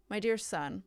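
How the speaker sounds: ordinary talk, nothing marked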